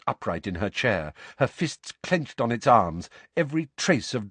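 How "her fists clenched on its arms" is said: ordinary delivery, nothing marked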